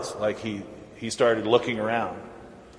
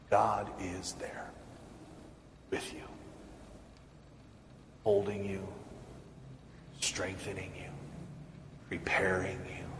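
soft breathy whisper, much softer; hushed whisper; 6 semitones lower, 14 dB quieter than usual. Acted. Preacher whispering God's closeness to the congregation, hushed. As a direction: lean in and whisper the comfort.

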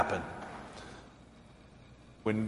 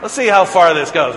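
laughing; 4 semitones higher, 11 dB louder than usual. Real, not acted. Laughing at his own prank.

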